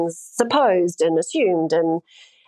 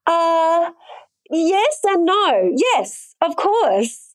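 high, slow words through giggles; real giggly admission, pitched up; laughing while answering; 9 semitones higher, much slower than usual. Real, not acted.

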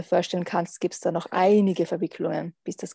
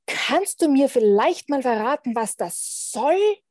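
furious chef; 6 semitones higher, 4 dB louder than usual. A narrator's reading. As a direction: demand answers like an angry boss. (wütender Chef)

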